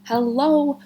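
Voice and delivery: usual speaking voice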